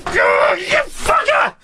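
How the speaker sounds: high, drawn-out strained curse; real gamer fury; enraged. Real, not acted. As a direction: stretch the insult out in rage. Raging at the boss.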